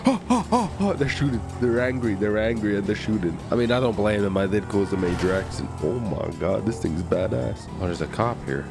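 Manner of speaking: usual speaking voice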